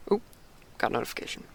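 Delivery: a quiet aside to yourself; muttered aside. Real, not acted.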